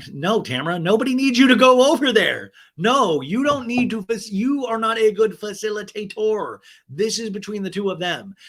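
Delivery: high, loud mock outrage; exasperated scolding. Host telling a castmate to stay out, exasperated. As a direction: scold her loudly, every word insistent.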